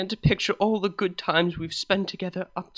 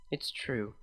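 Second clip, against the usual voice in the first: soft, softer confession; quiet admission; 4 semitones lower, 7 dB quieter than usual. A narrator's reading. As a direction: admit it quietly, ashamed.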